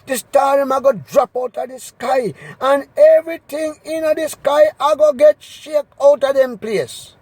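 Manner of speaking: usual speaking voice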